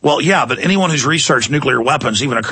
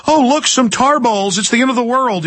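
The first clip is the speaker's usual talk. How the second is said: mock alarm; 9 semitones higher than usual. Mocking doomsayers hyping tar balls.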